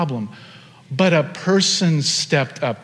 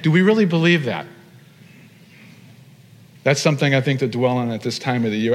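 ordinary talk, nothing marked

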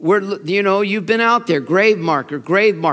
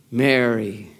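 tender; 7 semitones lower than usual. Acted. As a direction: say the name gently, dropping low. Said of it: "Jesus says to her".

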